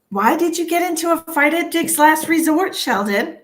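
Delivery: high, loud incredulous question; mock scolding. Mock scolding a viewer about fight.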